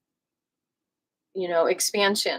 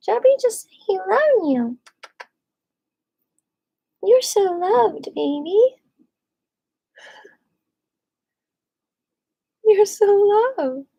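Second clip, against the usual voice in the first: high, slow doting coo; tender pet talk, high and slow; 10 semitones higher, 3 dB louder, slower than usual. Real, not acted. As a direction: dote on the cat in a high sing-song. Baby-talking to the cat.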